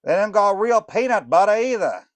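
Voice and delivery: fast and pushy, high up; Snickerdoodle voice. Acted. Snickerdoodle telling Morlock about the sugar, loud and high.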